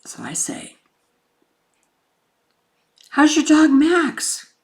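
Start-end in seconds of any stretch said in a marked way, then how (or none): 3.1-4.5 high, slow coaxing voice. Retold or imitated. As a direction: coax him gently and slowly. Coaxing William through door.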